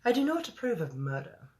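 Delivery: a hint of a disapproving English queen; Queen's English accent. The Queen rebukes her generals in a light English accent. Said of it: "I have to do the Queen's voice".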